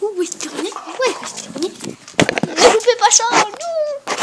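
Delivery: excited. Real, not acted. Excited waiting for dog.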